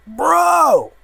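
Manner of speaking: loud, high excited shout. Real, not acted. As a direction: yell it out in delight. Excited reaction to comic.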